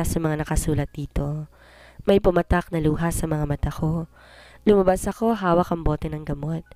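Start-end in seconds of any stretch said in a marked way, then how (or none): none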